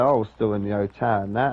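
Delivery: ordinary talk, nothing marked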